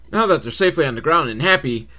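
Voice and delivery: normal talking voice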